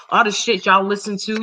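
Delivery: ordinary talk, nothing marked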